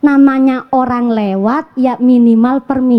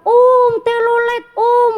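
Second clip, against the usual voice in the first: calling out; 9 semitones higher than usual. Retold or imitated. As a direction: shout to the passing bus like an excited kid. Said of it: "Saya puter tuh".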